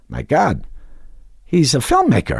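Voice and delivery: imitating everyone's astonished realization, pitch jumping up high; mock amazement. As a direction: quote an amazed reaction, lifting the pitch. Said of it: "everybody said".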